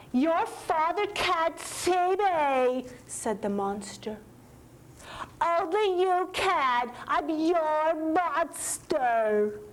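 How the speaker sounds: high, slow pleading little monster; begging monster. Acted. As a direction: plead like a tiny frightened creature. Monster speaks to Tommy.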